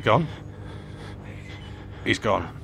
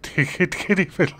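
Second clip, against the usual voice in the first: laughing; 10 semitones higher than usual. Real, not acted. Laughing at own joke.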